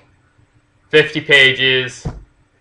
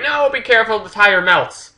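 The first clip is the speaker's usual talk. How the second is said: put-on warning girl; 9 semitones higher than usual. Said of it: "and she's like".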